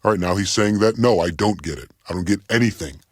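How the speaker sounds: ordinary talk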